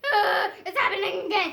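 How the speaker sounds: hammed-up laughing alarm; laughing panic. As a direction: panic with giggles.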